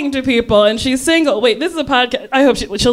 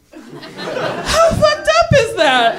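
high, slow laughing disbelief; laughing outrage; 14 semitones higher, much slower than usual. Real, not acted. Outraged comic aside.

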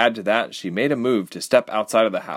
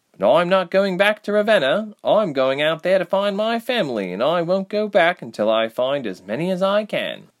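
high, slightly raised character voice; Reshma, determined young rebel; 10 semitones higher, 3 dB louder than usual. A narrator's reading. Reshma refusing to return to Ravenna, determined. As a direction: declare it high and firmly.

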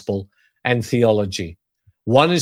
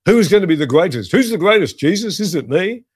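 quick, higher-pitched bickering voices; rushed, pitched-up mock squabble; squabbling disciples; 5 semitones higher, faster than usual. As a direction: mimic eager rivals arguing over rank. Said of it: "who were having an argument".

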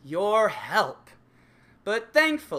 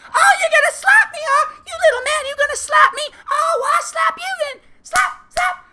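much higher, much louder screaming with a manic laugh; furious shriek; 12 dB louder than usual. Acted. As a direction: shriek him down like a raging girlfriend. Girlfriend screaming in acted quarrel.

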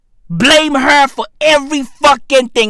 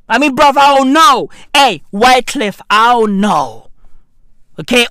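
ordinary talk, nothing marked

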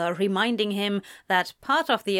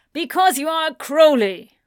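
higher, louder angry snap; 7 semitones higher, 8 dB louder than usual. A narrator's reading. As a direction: bite it out at your son. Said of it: "James snapped".

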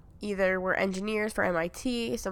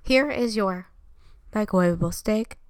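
talking microwave voice. Acted. Microwave voicing a greeting.

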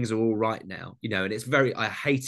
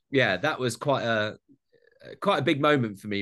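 normal talking voice

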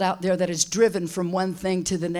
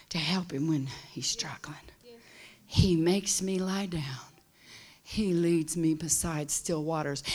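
a soft, intimate whisper; hushed whisper; 4 semitones lower, 7 dB quieter than usual. Acted. Preacher comforting the old and struggling, in a hushed whisper. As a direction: draw them close and whisper it.

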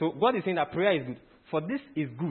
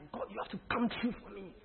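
high, hushed pleading prayer; soft, urgent plea, acted out; earnest entreaty; 7 semitones higher, 11 dB quieter than usual. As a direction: beg God under your breath. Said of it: "it's an earnest entreaty".

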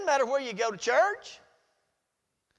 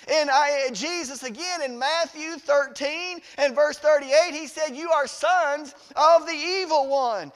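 loud, raised pulpit voice; full-force preaching; 3 semitones higher, 11 dB louder than usual. Real, not acted. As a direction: hammer out each verse at the congregation.

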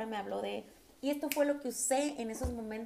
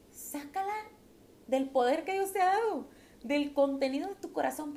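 impassioned preaching; 5 semitones higher, 5 dB louder than usual. Acted. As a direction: preach it out with fire from the heart. Urging a soft answer.

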